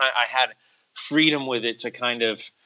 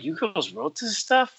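slow, high, amazed question; incredulous; 8 semitones higher, slower than usual. Retold or imitated. Asking the nice writers about dark lines, slow high disbelief. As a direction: act stunned that they wrote it. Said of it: "I'm like".

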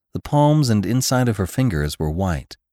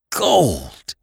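higher, falling cry of wonder; amazed cry; 5 semitones higher than usual. A narrator's reading. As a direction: cry out in astonishment. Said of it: "he cried".

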